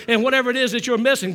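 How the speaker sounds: ordinary talk, nothing marked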